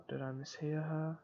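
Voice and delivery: plain reading voice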